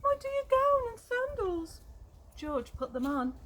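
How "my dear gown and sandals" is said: much higher and louder, thrilled; delighted. Acted. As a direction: cry it out as if greeting old friends. Finds his lost clothes.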